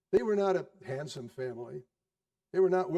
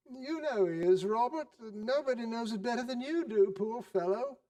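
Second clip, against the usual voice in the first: indignant wife; 7 semitones higher than usual. Acted. Wife appeals to husband.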